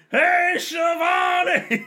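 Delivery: drawn-out high shout of a name across the arena; a wrestler bellowing slowly at a valet; mock yell. Wrestler yelling at Shivani ringside, drawn-out high shout. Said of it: "Tony yelling at".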